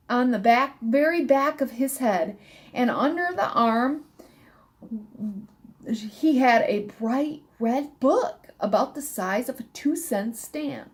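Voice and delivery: ordinary delivery, nothing marked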